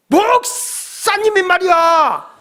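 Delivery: high, slow, laughing mock rebuke; scolding beggar. Beggar scolding pastor for giving only a thousand won, mock rebuke. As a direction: lay a guilt trip on the stingy pastor.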